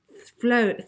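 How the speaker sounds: ordinary delivery, nothing marked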